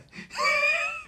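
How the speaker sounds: high laughing cry of emotion; moved laugh-cry. Real, not acted.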